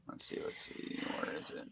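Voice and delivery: very soft unvoiced whisper; muttering to self. Real, not acted. Host searching the text for the word, whispering to self. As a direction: whisper absently under your breath.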